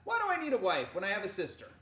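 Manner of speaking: loud, quick, hearty retort; booming voice. A narrator's reading. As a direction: boom it back at your sister. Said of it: "he said in a booming voice".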